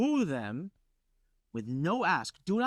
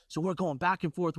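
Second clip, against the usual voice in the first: ordinary delivery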